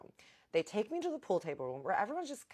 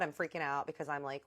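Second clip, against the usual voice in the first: normal talking voice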